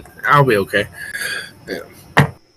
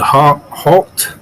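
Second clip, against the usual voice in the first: louder and higher stern order; stern command; 6 semitones higher, 4 dB louder than usual. Acted. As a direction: order them to stop. GM as Kroll ordering.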